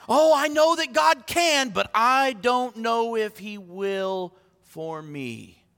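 put-on doubter's voice, slowing right down; mock doubting. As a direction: act out a hesitant believer, dragging to a crawl at the end. Acts out doubting believer.

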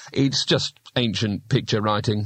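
plain reading voice

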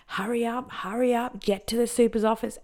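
high, urgent mock scream without volume; mock screamed order. Supervisor hurrying inmate to her office, imitated scream. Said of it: "she was screaming".

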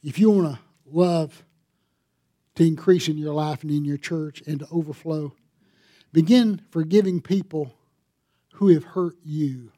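loud, pressing sermon appeal; a preacher pleading from the pulpit; forceful preaching. Acted. Exhortation to congregation.

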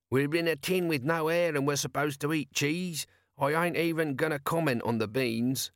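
two weary watchmen griping in a cramped tin; lightly voiced Sergeant Colon and Corporal Nobbs complaining; gruff bickering watchmen. Colon and Nobby bicker in submarine.

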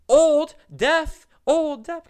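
yelled high and loud, teacher calling out; mock shout of an old teacher. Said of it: "he's like".

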